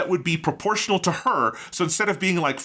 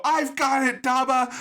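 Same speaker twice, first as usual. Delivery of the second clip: higher, cheery little fairy call; fairy heroine; 9 semitones higher than usual. Retold or imitated. Fairy Lilith swoops in to save Daba, chirpy call. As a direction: pipe up brightly like a tiny heroine. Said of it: "Lilith fly out and go".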